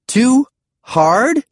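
high, incredulous question; stupefied, pitched right up. A narrator's reading. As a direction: echo it back in high-pitched disbelief.

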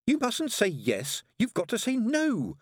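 put-on high, slow, insistent child; high-pitched reproachful prompting; hissed reproach. A narrator's reading. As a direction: coach the suspect insistently in a high girl's voice. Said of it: "hissed the head torturer".

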